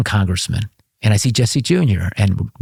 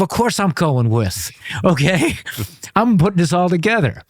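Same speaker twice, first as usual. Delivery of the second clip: inner glee; 8 semitones higher than usual. Retold or imitated. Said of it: "I'm thinking to myself".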